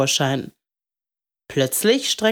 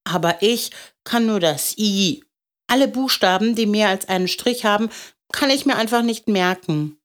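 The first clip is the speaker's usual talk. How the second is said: put-on higher child's voice; discouraged little boy; 5 semitones higher than usual. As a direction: own up to failing like a small boy.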